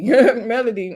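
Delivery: ordinary delivery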